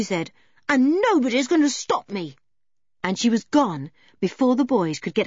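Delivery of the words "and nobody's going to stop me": put-on high, determined child's voice; defiant. A narrator's reading. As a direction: dare anyone to object. George defiantly sets off.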